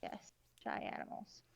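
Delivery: soft breathy whisper. Real, not acted. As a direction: whisper it gently. Soft reply while drawing.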